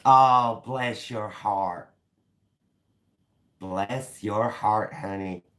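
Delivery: slow, high southern drawl; southern accent. Shown as a demonstration. Blessing someone's heart for viewers, southern accent. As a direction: draw it out like a sweet American Southerner. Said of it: "I do like a southern accent".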